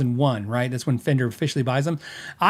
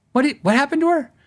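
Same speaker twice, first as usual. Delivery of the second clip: alarmed; 9 semitones higher than usual. Retold or imitated. Mock alarmed reaction.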